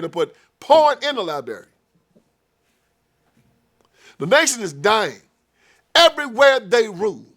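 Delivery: loud, high, driving sermon tirade; fiery preaching. Real, not acted. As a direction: rail against them from the pulpit. Preaching at full force.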